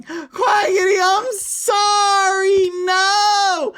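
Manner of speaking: very high, drawn-out loud wail; screamed, stretched-out pleading; wailing apology. Pretend. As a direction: cry out in melodramatic regret. Wails apology after departed Quackity.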